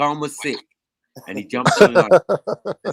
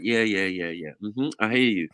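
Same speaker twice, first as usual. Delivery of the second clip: straight deadpan voice; 4 semitones lower, 6 dB quieter, slower than usual. Retold or imitated. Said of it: "He was a straight voice".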